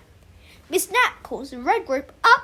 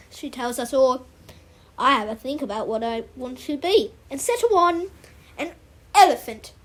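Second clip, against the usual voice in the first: usual speaking voice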